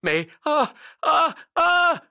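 high, loud cries of panic; terrified gasps. A narrator's reading. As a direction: gasp in mounting terror. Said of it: "haleta l'autre".

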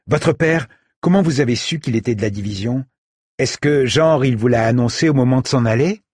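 put-on a boy blurting a nosy question; curious boy. A narrator's reading. Said asking the siblings about their father, curious and quick. Said voiced in story.

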